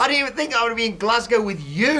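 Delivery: excited. Real, not acted. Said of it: "excited about Glasgow trip".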